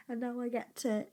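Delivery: ordinary delivery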